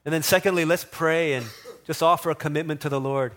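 earnest pastor leading a prayer. Acted. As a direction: invite the church into prayer, steady and sincere. Leads congregation in prayer.

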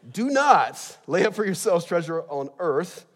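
"Do not" punched loud and high, then drawn out; emphatic correction. Real, not acted. Preacher rereading a botched verse, loud stress on "Do not". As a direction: hit "Do not" hard and slow down.

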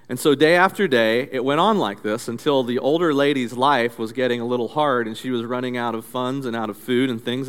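ordinary talk, nothing marked

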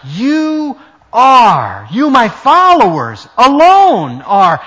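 "are" and "alone" hit loud, "alone are" slowed; emphatic are. Shown as a demonstration. Preacher insisting to his followers, loud and slow. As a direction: pound out "You are" and "alone are". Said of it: "are emphatic".

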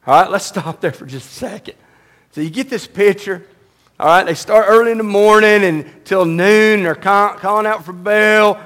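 usual speaking voice

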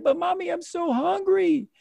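put-on very high little child whine; squeaky child voice pleading; whiny kid. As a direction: play the hungry little boy begging. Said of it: "me being like".

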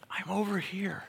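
breathy whisper, no voice. Acted. God whispering to draw someone's attention. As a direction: whisper it like a secret call.

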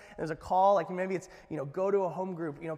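ordinary delivery

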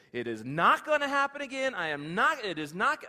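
fierce vow to never slip again; loud vow. Acted. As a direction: shout the promise to yourself. Peter vowing after first denial.

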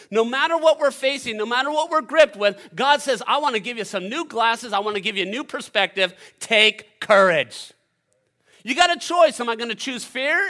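loud, high-pitched preacher; full-force preaching. Real, not acted. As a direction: preach it urgently to the whole church.

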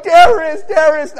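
high, loud mock accusation; mock alarm. Traveler imagines denouncing lady to stewardess, mock alarm. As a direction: blurt it out like a joking alarm.

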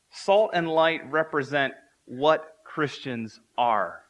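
ordinary talk

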